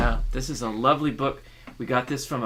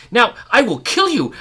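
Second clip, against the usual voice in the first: loud, higher furious threat; threatening rage; 11 semitones higher, 8 dB louder than usual. Acted. Man seizing the woman, loud furious threat. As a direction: roar it at her.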